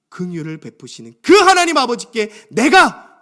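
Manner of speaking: loud, high, fervent prayer to God the Father; 간구, fervent supplication. Acted. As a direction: proclaim God's greatness in full-voiced prayer. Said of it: "바른 인식을 가지고 간구하는 거예요". (높고 힘찬 목소리로 드리는 간구)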